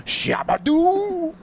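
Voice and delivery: mock Adam Sandler bit; Adam Sandler catchphrase. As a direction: drag it out in a goofy high sing-song like Adam Sandler. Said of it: "Is that Adam Sandler?".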